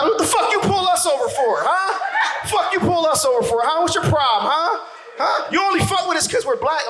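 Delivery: high indignant yelling at the cops. Retold or imitated. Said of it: "when they get pulled over by the cops".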